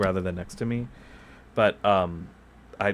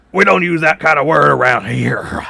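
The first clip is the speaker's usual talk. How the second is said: high, loud put-on character voice pressed to the mic; town character voice; 6 semitones higher, 13 dB louder than usual. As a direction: scold them in the character's big voice. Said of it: "a character in this town that I use that voice for".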